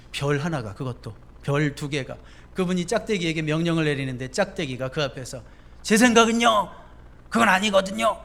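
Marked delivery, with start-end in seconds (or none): 5.8-8.2 insolent soldier, louder and higher; cheeky private talking back. Pretend. Preacher voicing a private answering back to a two-star general, insolent.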